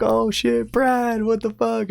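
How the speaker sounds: high-pitched hammed-up disbelief; mock crowd reaction. Retold crowd ribbing Brad.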